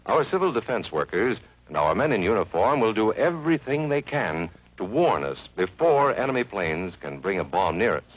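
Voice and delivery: ordinary talk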